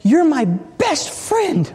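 high, slow, heartfelt declaration; a touch of the big guard's joy. Retold or imitated.

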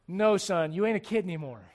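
ordinary talk

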